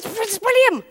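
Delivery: high, pressed retold urgency; urgent alert. Telling Peter who is sitting so close, urgent. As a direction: alert your companion urgently.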